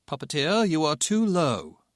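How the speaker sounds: lightly voiced tower controller; a hint of an urgent radio call. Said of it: "the tower controller called".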